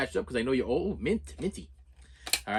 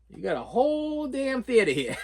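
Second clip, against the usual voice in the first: slow, higher, disbelieving with a laugh; incredulous; 7 semitones higher than usual. Retold or imitated. To the girls sitting beside him, slow laughing disbelief. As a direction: ask it like the empty theater is obvious. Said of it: "And I'm like".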